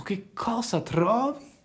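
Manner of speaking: the fox hooks his listener with a vision of gold; building wonder. Acted. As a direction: start hushed and grow into a grand promise. Fox dazzles Pinocchio with riches.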